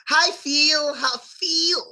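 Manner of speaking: high-pitched "feel" with a hard, stretched ee; imitating Russian mispronunciation. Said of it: "like, real hard".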